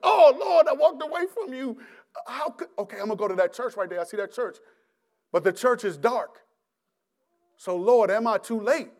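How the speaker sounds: high, pleading prayer; retold frantic cry to God; desperate plea. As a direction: beg the Lord in panic.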